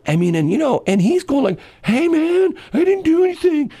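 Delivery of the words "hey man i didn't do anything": very high, pleading mock protest; protesting suspect. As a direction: imitate a tackled man protesting his innocence, pitched way up. Voicing tackled man protesting.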